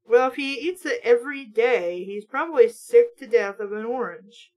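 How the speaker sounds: normal talking voice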